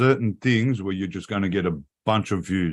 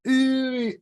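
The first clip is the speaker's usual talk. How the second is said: high cooing ooh, imitating a girl in a clip; mock girly ooh. Mocking the girl in the short video, high cooing. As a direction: coo it up high like the girl on Facebook. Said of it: "on a girl that goes".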